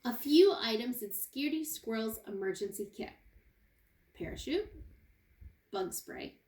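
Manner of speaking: ordinary talk, nothing marked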